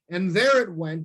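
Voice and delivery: ordinary delivery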